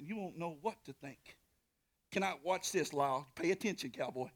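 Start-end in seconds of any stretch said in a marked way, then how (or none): none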